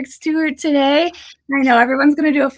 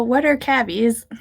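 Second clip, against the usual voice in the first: ordinary delivery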